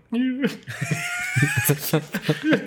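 high squealed mock sob; mock weeping. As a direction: imitate a weepy, high-pitched whine. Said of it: "like, weeping".

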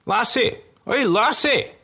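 put-on a tipsy soldier calling out to the girl; drunk soldier calling. Soldier calls to April.

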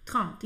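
"Trente" with a nasal vowel, short; French trente. Shown as a demonstration. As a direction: model "Trente" alone. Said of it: "a maneira formal é o trente".

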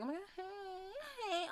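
high, soft, slow gushing hello; mock gushing greeting. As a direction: coo a fake-sweet hey, hey. Mimicking Lori greeting everyone.

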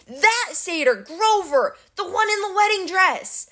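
high, exasperated holler; bellowed. A narrator's reading. Captive Clarice points out Grover, exasperated high bellow. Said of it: "Clarice bellowed".

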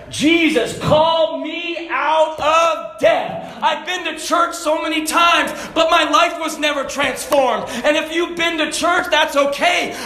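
high, loud pulpit proclamation, stretching key words; preaching at full force. Acted.

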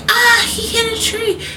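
squeaky mock alarm; startled gasp. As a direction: yelp as if you saw him crash. Family watching ball fly.

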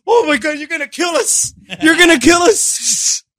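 panicked high plea. Retold or imitated. Friend panicking in the van, high frightened whine. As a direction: whine it like you're sure you'll crash. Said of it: "He's just like".

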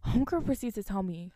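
normal talking voice

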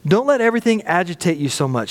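ordinary talk, nothing marked

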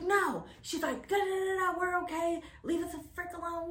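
high, sassy brush-off; friend telling off stranger. Retold or imitated. Best friend sending the stranger off, high sassy brush-off. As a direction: shoo the creep away.

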